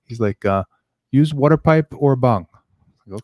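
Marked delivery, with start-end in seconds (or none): 1.1-2.5 put-on slow, higher, louder Indian accent; doctor's advice in a thick Indian accent. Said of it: "That's the worst Indian accent".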